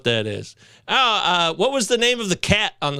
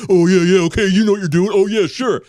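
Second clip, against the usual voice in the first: louder, lazy mock dude; lightly voiced generic bro agreeing; standard dude voice; 6 dB louder than usual. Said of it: "I used to have the standard dude voice".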